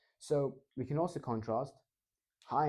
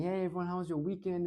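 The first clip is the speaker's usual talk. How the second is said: warm friendly greeting; 7 semitones higher than usual. Shown as a demonstration. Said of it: "We're very warm".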